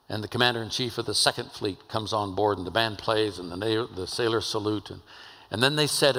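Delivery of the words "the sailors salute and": usual speaking voice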